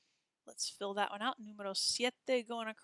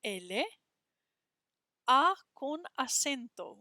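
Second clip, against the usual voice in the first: ordinary delivery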